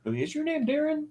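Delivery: Louisiana lady. Retold or imitated. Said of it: "She's from Louisiana".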